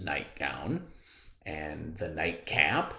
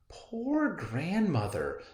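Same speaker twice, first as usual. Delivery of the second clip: put-on girlish thought; 9 semitones higher, slower than usual. Girl's thought.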